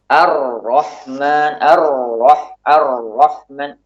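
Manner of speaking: very slow "Ar-Rahman", the r flowing briefly; slight flow on ro. Shown as a demonstration. Teacher demonstrating the ro for students, slowly. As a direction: let the r of "Ar-Rahman" roll a little. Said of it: "ro juga demikian".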